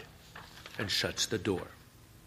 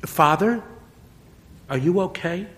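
put-on concerned boy; 9 semitones higher, 5 dB louder than usual. Boy worried about priest.

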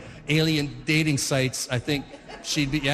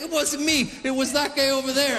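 high-pitched comic denial; mock innocent, pitched up; protesting duck; 9 semitones higher than usual. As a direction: shift the blame like a flustered duck. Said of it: "and he's like".

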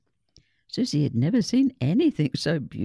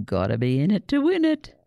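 louder, upbeat teacher's nudge; bright mock pep talk, louder; encouraging; 5 semitones higher, 6 dB louder than usual. A narrator's reading. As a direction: cheer a nervous pupil on. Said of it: "Missus Eaton encouraged".

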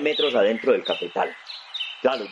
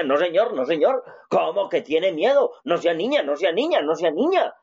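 a stern dad voice, pitched higher, repeating the order; scolding father; 7 semitones higher than usual. Retold or imitated. Father scolding his frightened little son, mock stern. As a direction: scold the boy for being scared.

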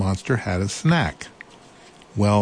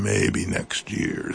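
lower, slow and unwilling; lightly voiced reluctant monster; 12 semitones lower, 4 dB quieter, much slower than usual. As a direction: drag it out low as a monster putting it off. Monster's voice, read aloud.

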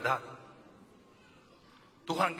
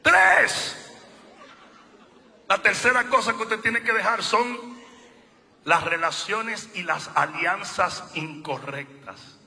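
loud, high, slow preaching, hammering each name; preaching at full force; 7 semitones higher, 10 dB louder, much slower than usual. Acted. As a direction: preach it out, rising to a shout. Preacher builds to shouting.

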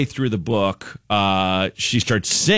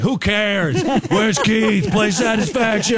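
put-on drunk uncle heckling; 10 semitones higher, slower than usual.

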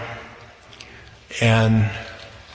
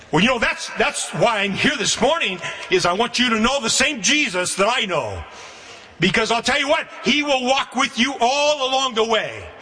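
raised, ringing preacher's voice, pitched well higher; preaching; 8 semitones higher than usual. Acted. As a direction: lift it up and proclaim to the congregation. Closing exhortation.

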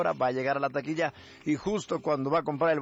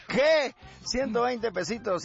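mock outrage at the fare; shocked; 9 semitones higher, slower than usual. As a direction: balk at the price. Norteño shocked at taxi fare.

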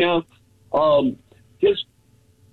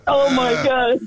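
laughing; 8 semitones higher, slower than usual. Real, not acted. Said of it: "I can't stop laughing".

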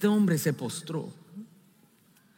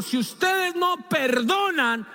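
high, loud, slow proclamation; full-force preaching; preached warning; 14 semitones higher, 4 dB louder, slower than usual. Acted. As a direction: thunder out Jesus' warning. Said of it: "Jesucristo dijo".

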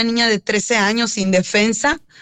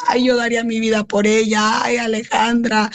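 half-acted wail of despair from the officer; a hint of the officer's desperate scream; retold desperate cry. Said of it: "a gritar desesperada".

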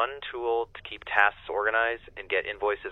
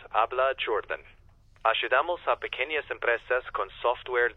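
friendly Spanish phone greeting. Shown as a demonstration. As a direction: answer the call in Spanish, like a helpful receptionist. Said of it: "can you speak in a Spanish accent?".

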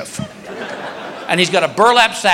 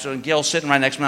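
ordinary talk

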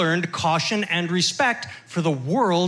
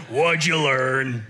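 low, slow dad voice; 6 semitones lower, slower than usual. Retold or imitated. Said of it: "That's what he asked".

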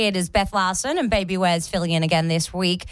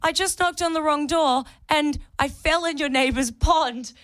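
flustered apology; 7 semitones higher than usual. Retold or imitated. Soaked agent apologizing to the neighbour at the door, flustered. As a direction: gush an awkward apology. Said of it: "I was like".

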